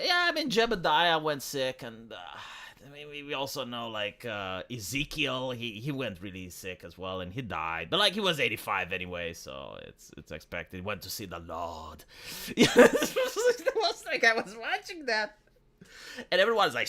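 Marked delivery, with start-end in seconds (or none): 0.0-12.0 lightly voiced elder, fading lower and softer; a hint of an unbothered old farmer, trailing off. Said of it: "But, but, like, did anyone die?". 12.3-15.4 real laugh, pitched up high and slowed. Real, not acted. Laughing at his own impression.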